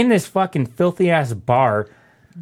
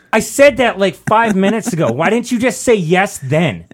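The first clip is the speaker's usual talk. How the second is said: higher, louder annoyed retort; mock exasperation at the drunk; a touch of retold irritation; exasperated; 5 semitones higher, 4 dB louder than usual. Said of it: "I was like".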